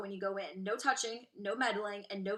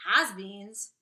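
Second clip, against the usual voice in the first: loud indignant cry; cried out; 8 dB louder than usual. Acted. Violet protesting to Wonka, loud indignant cry. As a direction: call it out, offended. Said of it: "cried Violet".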